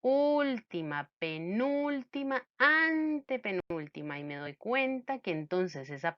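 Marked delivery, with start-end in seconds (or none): 0.0-4.2 slow, higher-pitched count, each word drawn out; counting off slowly. Shown as a demonstration. Teacher counts syllables back from the end, slowly and deliberately. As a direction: count off each position with stretched stressed syllables. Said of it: "Y empiezo a contar".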